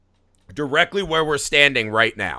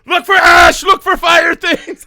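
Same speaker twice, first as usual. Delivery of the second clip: high laughing panicked scream; 12 semitones higher, 10 dB louder, slower than usual. Retold or imitated. Retelling how he screamed at his girlfriend, laughing panic. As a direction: yell orders in a laughing panic.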